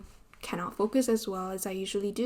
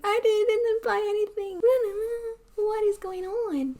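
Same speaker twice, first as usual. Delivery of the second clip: higher, whiny mock-cry protest; mock distressed protest; 11 semitones higher than usual. Retelling her confused reaction to an accusation, mock crying. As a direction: play it as a hammed-up wail of confusion. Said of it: "and I'm like".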